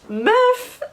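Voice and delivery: very high, incredulous "meuf". Acted. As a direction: squeak it in disbelief at a friend. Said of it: "like, stupid or ridiculous".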